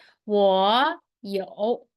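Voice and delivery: 我有 stretched out slowly; slow model phrase. Shown as a demonstration. Teacher demonstrates the answer to the class, slowly. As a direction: drill 我有 slowly for students. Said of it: "That will be".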